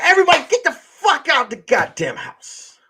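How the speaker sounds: high-pitched exasperated order; acted-out anger at a roomful of people; retold angry order. Throwing everyone out, told again.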